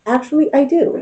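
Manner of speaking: a hint of shy confessing; sheepish admission. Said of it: "And you're like".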